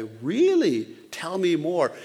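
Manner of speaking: eager. Retold or imitated. Eager barber's reply.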